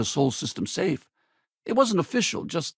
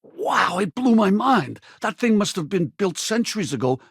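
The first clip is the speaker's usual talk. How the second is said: amazed; 5 semitones higher than usual. Acted. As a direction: relive the awe, stretching the words. Marvelling at old structure in story.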